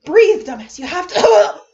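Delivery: desperate self-command. Acted. Kaito ordering himself to breathe, desperate and loud. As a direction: force the words out through panic.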